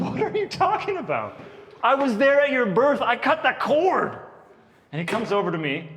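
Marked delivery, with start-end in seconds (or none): 0.0-4.2 higher, incredulous dad; a hammed-up disbelieving father; mock outrage. Dad answering his son, mock indignant. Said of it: "and I was like".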